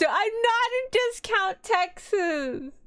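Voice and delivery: high, slow, drawn-out objection; indignant protest. Real, not acted. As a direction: protest the teasing in a high, stretched voice. Protests chat's teasing about Texas.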